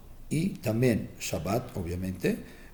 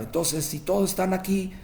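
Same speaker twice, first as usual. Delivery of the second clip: high mock yell from the sickbed; a miserly father cries out about his shop; retold shout; 8 semitones higher than usual. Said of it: "Y empieza a gritar". (grito exaltado y agudo de un padre avaro)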